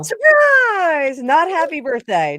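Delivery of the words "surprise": high, loud, drawn-out party yell; mock surprise shout. Springing a surprise on him, high mock shout.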